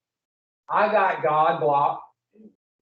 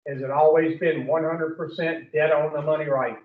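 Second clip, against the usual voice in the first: ordinary talk, nothing marked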